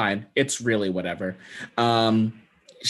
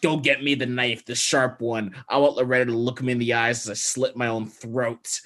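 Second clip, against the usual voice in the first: normal talking voice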